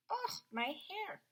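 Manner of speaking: soft, slow aside about her hair; a real hushed aside; whispered aside. Real, not acted. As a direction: murmur it off-mic. Teacher aside, hair undone.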